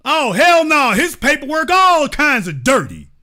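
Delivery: loud indignant outcry; outraged call-out. Acted. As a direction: blast it like you caught him red-handed. Outraged reaction.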